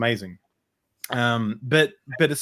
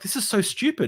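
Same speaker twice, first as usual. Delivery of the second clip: mock incredulous, pitched much higher; retold incredulous protest; 10 semitones higher than usual. Client to psychologist about the repetition, retold incredulous protest. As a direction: protest in a high voice, lightly acted.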